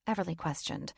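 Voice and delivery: ordinary delivery, nothing marked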